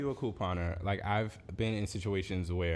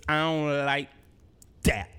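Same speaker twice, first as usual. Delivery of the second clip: louder, higher, drawn-out I don't like that; emphatic mock disapproval; 5 semitones higher, 6 dB louder, slower than usual. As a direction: deliver "I don't like that" like a stern verdict. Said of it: "Gotta say it like that".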